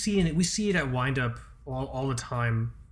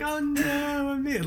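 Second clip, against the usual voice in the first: much higher, squeaky mock "oh no"; mock panicked victim; 13 semitones higher, slower than usual. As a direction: play the doomed booth owner in falsetto. Mock victim being eaten.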